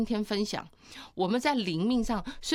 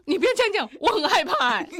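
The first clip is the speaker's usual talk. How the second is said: high, loud, laughing mock fear; husband recoiling in mock fright, much higher and louder; mock frightened husband; 10 semitones higher, 8 dB louder than usual. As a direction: play the spooked husband, half laughing. Husband startled by her sweetness.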